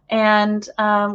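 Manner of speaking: ordinary delivery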